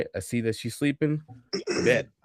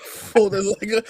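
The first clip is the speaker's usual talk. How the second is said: laughing through words; 4 semitones higher, 4 dB louder than usual. Real, not acted.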